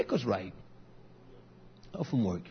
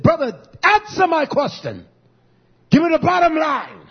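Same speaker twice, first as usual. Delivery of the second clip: firm demand; 7 semitones higher, 6 dB louder than usual. Retold or imitated. Pastor retells pressing a contractor for the price, louder and insistent. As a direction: insist hard that he give you the bottom line.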